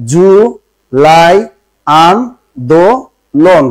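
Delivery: জু, লাই, আন, দো, লন each said apart with pauses; syllable by syllable. Shown as a demonstration. Teacher sounding out syllables for students, one at a time. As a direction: break জুলাই আন্দোলন into separate syllables. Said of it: "উচ্চারণ করে".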